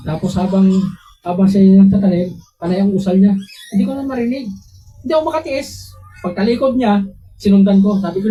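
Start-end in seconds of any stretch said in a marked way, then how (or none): none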